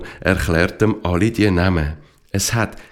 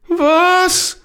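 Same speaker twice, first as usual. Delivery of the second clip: sharp, much higher "what?!" of disbelief; critical disbelief. Acted. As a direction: scoff at the plan in a high squeak. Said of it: "sagt der Esteban kritisch".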